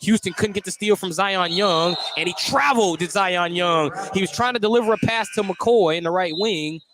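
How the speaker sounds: normal talking voice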